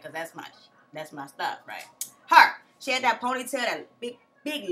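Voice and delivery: usual speaking voice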